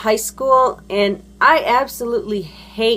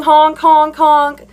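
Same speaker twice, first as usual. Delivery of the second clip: raised, loud mock horn honks; imitated car honking; 6 semitones higher, 7 dB louder, slower than usual. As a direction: honk like an impatient driver's horn. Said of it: "guy behind me is honking".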